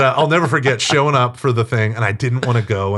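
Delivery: ordinary delivery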